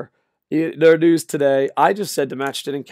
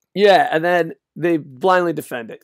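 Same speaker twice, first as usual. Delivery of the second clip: usual speaking voice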